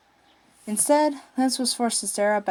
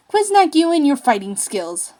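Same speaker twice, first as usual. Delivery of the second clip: loud, higher angry yell; 7 semitones higher, 8 dB louder than usual. Acted. Captive Lance yelling at his captor, loud and furious. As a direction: yell it while struggling against his grip. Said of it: "Lance yelled".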